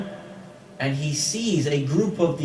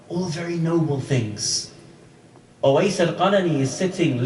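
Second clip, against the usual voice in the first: ordinary delivery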